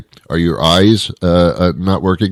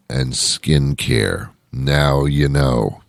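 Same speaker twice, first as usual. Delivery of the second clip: slow punchline; 4 semitones lower, 4 dB quieter, much slower than usual. Acted. Punchline delivered slowly.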